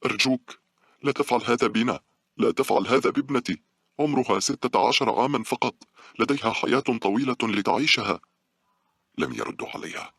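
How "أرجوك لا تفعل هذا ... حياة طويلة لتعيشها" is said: high, loud, distraught begging; a mother losing her composure; tearful pleading. A narrator's reading. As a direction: beg for your child's life, voice raised. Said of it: "بدأت تبكي وهي تفقد رباطة جأشها".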